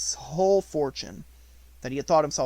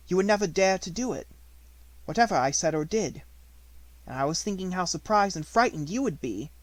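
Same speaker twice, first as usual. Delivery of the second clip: put-on bold young girl; 6 semitones higher than usual. A narrator's reading. Sarah voiced, confident daring.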